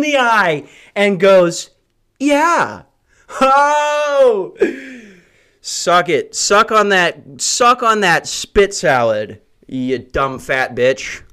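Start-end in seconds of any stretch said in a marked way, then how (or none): 2.2-4.6 mock customer voice. Customer reacts to him. 5.6-11.2 slow gloating taunt. Retold or imitated. Gloating at customer in retelling.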